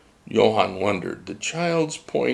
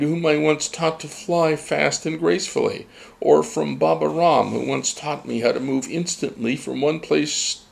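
higher, halting humble gull; hesitant Johann; 8 semitones higher than usual. A narrator's reading. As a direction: ask nervously and politely. Johann questions the child.

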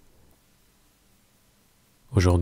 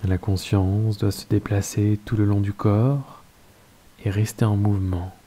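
calm, unhurried Yoga Nidra guidance with long pauses; guided meditation. Acted. Teacher settling listeners into Yoga Nidra, guided meditation voice.

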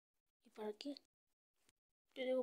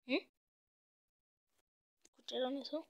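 usual speaking voice

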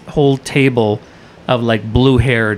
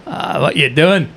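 slow, higher Scottish cabbie; thick Scottish accent; 4 semitones higher than usual. Retold or imitated. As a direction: ask like a nosy Scottish driver. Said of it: "thick Scottish accent cab driver".

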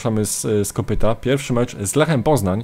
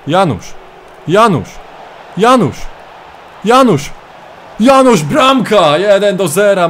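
repeated name rising higher and louder into a slow goal scream; building goal call; 8 semitones higher, 6 dB louder, slower than usual. Acted. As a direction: build the call until the ball hits the net.

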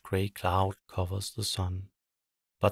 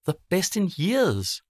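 higher, louder happy exclaim; delighted villagers; 10 semitones higher, 4 dB louder than usual. Acted. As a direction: cheer about the party. Villagers cheering.

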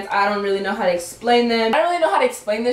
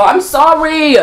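higher, louder playful sorry; louder apology; 5 semitones higher, 9 dB louder, slower than usual. Real, not acted. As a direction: call the apology out to everyone. Louder repeat of her apology.

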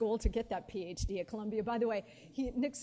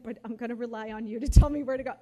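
a suddenly loud, quick, mock-bold reply; retold loud reply; 15 dB louder, faster than usual. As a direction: push it out loud and fast, half joking with the boss. Retelling her own reply.

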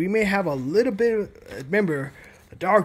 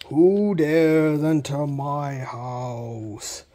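slow, low ghostly voice; menacing haunted house ghost; unearthly voice; 5 semitones lower, much slower than usual. Acted. Ghost voice threatens visitors.

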